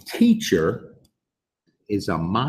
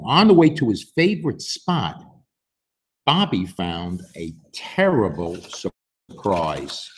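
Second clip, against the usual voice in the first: plain reading voice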